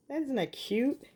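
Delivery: charmed by a tiny gold bee; cooing delight. Real, not acted. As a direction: ask it like you're melting over something tiny. Crafter cooing over bee charm.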